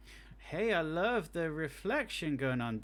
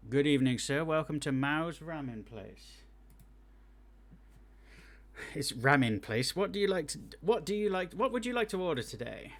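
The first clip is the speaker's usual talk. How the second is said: waiter voice; 3 semitones higher, 5 dB louder than usual. Acted. Voicing the ramen waiter.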